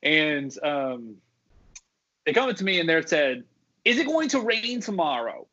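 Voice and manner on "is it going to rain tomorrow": high-pitched mock New Jersey-ish guest; high, put-on tourist voice; imitated guest voice. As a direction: imitate a guest asking in a raised, accented voice. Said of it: "like, in that voice".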